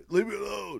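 retold kid hollering like Stallone; Stallone yell. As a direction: mimic Stallone yelling, without real volume. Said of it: "he was yelling like Stallone".

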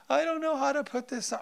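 put-on high helpless kid voice; whining child. Dad imitating child who can't put shirt on. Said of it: "then they come up and say".

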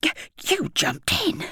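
high, stammering splutter; breathless lollipop man spluttering in shock. A narrator's reading. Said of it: "he spluttered".

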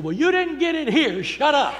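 high, loud, laughing rebuke; exasperated deacon. Retold or imitated. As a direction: tell him off while cracking up. Deacon snapping at the shouter.

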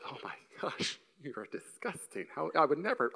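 hushed, scandalized breathy gasp; a hint of a prim whisper; mock disgust. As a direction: sneer at the moviegoer under your breath. Mocking his judgmental younger self.

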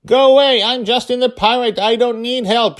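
high, loud defiant yell into the wind; stubborn little pirate shouting back; child yelling. Acted. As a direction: push him away with a shout. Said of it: "she yelled at him through the wind".

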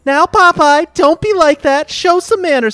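scandalized high falsetto; high-pitched, scandalized scolding; Olive Oyl. A narrator's reading. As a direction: chide in a very high, loud cartoon voice. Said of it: "Olive Oyl's voice was scandalized".

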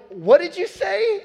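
can't believe what you just heard; dad stunned by son's reply; astonished. Retold or imitated.

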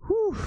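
high, breathy "whew"; excited whew. Real, not acted. Bible teacher pausing after getting worked up before the last chapter, excited whew. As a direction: blow out a high puff of excitement. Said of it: "Sorry, I'm getting excited".

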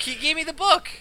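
high, loud protest. Real, not acted. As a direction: insist you did nothing wrong. Defends himself, laughing.

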